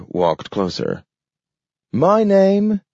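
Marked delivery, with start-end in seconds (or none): 1.9-2.8 high voice, each word pronounced carefully; a dog proudly giving his name; talking dog, careful. A narrator's reading. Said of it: "pronounced carefully".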